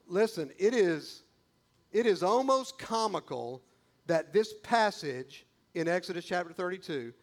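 normal talking voice

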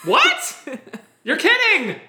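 high, loud, squealed disbelief; hammed-up, laughing amazement; mock shock. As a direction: feign astonishment at big news.

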